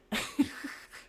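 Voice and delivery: airy high laugh; breathy laugh. Real, not acted. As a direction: laugh under your breath. Laughing at own line.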